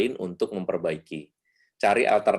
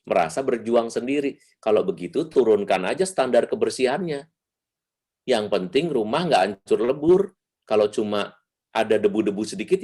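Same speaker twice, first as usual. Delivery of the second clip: usual speaking voice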